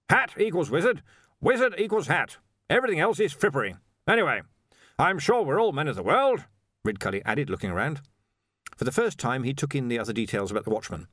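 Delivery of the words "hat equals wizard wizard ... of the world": high, emphatic, assured pronouncements; blustering Arch-Chancellor. A narrator's reading. Arch-Chancellor defends wearing only a hat, blustering. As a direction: dismiss objections with breezy authority.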